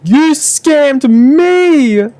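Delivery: drawn-out, very high mock scream; hammed-up high-pitched yell, every word stretched. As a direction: wail it as if from a mountaintop. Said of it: "screams at the top of the mountain".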